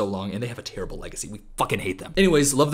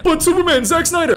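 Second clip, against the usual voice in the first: much higher and louder, gushing; fanboy outburst; 7 dB louder than usual. Acted. Commentator erupting about Superman, fanboy shout. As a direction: shout it like a true believer.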